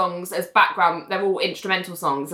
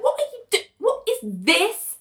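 irritated surprise; 12 semitones higher than usual. Retold or imitated. Renee irritated, retold.

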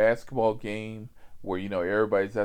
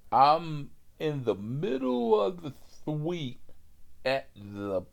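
put-on drunk customer voice; 5 semitones higher, slower than usual.

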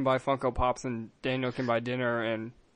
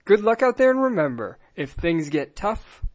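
slow, measured sign-off read; podcast outro; 6 semitones higher, slower than usual. Acted. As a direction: close the show gravely and slowly.

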